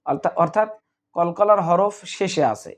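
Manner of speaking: usual speaking voice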